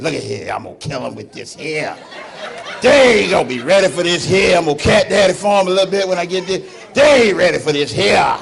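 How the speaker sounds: put-on strut it like an old man showing off his hair; old man primping. Old men voiced dressing up.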